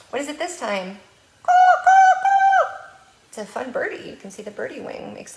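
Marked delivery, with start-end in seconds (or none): 1.4-2.8 very high, loud bird caws; crow caw. Shown as a demonstration. Librarian cawing loudly for the bird picture.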